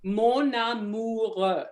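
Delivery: slow Mon amoureux, open vowels; teacher models Mon amoureux slowly; open French vowels. Shown as a demonstration. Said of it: "mon amoureux is a little bit more open".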